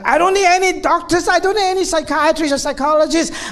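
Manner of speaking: high, loud anguished refusal; patient rejecting the therapist in anguish; defiant despair. Retold or imitated. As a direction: push the doctor away, desperate and raw. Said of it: "and she said".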